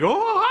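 rising high "oh, ah" cry; imitated manager's alarmed yelp; mock shocked gasp.

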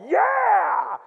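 high, drawn-out victory cry; acted exultant cheer; triumphant shout. Re-enacts triumph after crossing.

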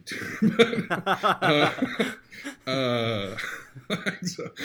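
high, loud laughing aside; rueful real laughter. Real, not acted. Laughing at own crying story.

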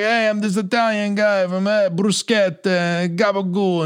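mocking Sopranos fans, fake Italian accent; mock Italian wannabe. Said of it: "'cause they watch Sopranos too much".

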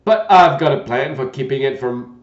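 put-on loud, gentle old knight; a kindly, bumbling old knight. Knight voiced in story.

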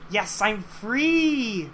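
celebrate, drawing the last word right out; jubilant cheer. Acted. Acted character cheering at being unwatched.

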